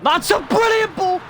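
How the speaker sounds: loud, high delighted yell; excited shout. Real, not acted. Gamer praising a pass, loud excited shout. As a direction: burst out at a great pass.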